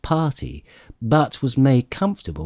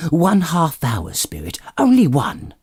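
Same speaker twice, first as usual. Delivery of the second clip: put-on higher, eager pleading old man; begging like a boy; 7 semitones higher than usual. A narrator's reading. Scrooge begging the spirit to stay longer, higher and eager. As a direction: beg for just a little more time.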